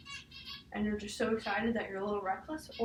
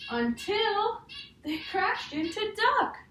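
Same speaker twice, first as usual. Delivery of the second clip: higher and louder on the crash; dramatic build; 11 semitones higher, 6 dB louder, much slower than usual. Acted. Teacher reading to a child, rising louder at the crash. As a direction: land the crash with storybook excitement. Climax of story page.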